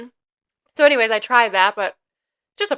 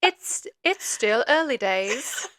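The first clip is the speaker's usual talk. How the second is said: slow, softer go at a British accent; tentative mock-British delivery; British accent attempt; 4 dB quieter, much slower than usual. Shown as a demonstration. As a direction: try British carefully, drawing it out. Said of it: "That was a British accent?".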